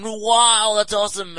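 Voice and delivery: fake gushing wow. Fundraiser praising the teen, loud fake delight. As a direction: overdo the praise.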